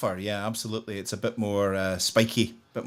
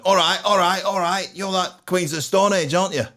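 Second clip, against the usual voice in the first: Manchester accent impression; 8 semitones higher, 4 dB louder than usual. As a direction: swagger it out like a cocky Manc singer. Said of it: "and he went".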